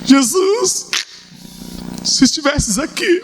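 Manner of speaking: high, strained crying plea; tearful plea. Retold or imitated. Mary weeps to Jesus that he came too late, tearful high plea. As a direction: cry out to him from grief. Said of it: "A entonação é outra".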